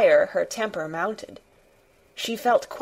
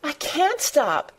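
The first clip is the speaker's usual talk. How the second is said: put-on high, slow wail of a distressed boy; plaintive, pitched well up; helpless child's cry, drawn out; gasped, sobbing; 8 semitones higher, slower than usual. A narrator's reading. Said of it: "he gasped and sobbed".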